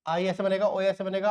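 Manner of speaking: usual speaking voice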